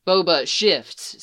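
plain reading voice

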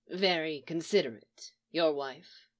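low, dry male character voice; Leonard's low voice. A narrator's reading. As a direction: drop low for a guarded man. Narrator voices Leonard, deadpan.

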